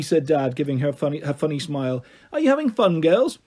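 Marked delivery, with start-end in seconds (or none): 2.3-3.4 bright, high cheery dad greeting; dad cheerfully greets the girls at the party; jolly dad. Acted.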